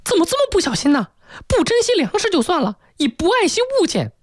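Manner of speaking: very high-pitched, rapid-fire scolding mother; shrill scolding. Acted. Mother scolding daughter over a broken rice bowl, shrill and angry. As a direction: put on a piercing, squeaky nagging voice. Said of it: "妈妈是立即尖着嗓子就大声责骂他".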